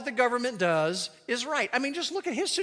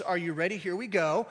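ordinary talk

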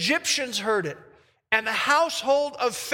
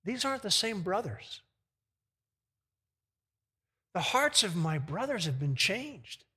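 soft, low inner thought; hushed realization; 3 semitones lower, 6 dB quieter than usual. Acted. Joseph realizes his brothers have changed, hushed wonder. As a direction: think it aloud quietly, with long pauses.

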